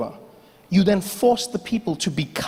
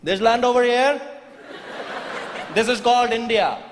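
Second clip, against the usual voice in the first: put-on Indian accent; 4 semitones higher than usual. Indian farmer answering.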